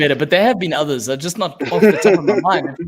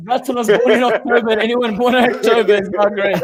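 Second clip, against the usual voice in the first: 1 mock decree; 5 semitones higher than usual. Mock pronouncement about birthdays.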